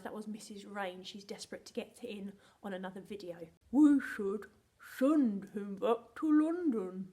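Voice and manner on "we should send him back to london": very loud, slow put-on voice; funny different voice. Teacher shows pupils a different voice, booming and slow. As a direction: boom it out in a silly character voice. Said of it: "Let's try that in a different voice".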